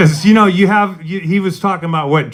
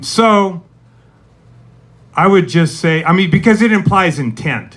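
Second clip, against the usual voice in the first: usual speaking voice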